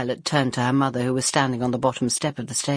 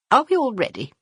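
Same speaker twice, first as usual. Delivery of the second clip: mother's question; 11 semitones higher, 3 dB louder than usual. Acted. Mother asking.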